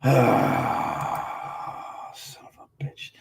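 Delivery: whispered, under his breath; whispered curse. Real, not acted. Streamer annoyed at something on screen, real whispered curse. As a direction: swear quietly to yourself.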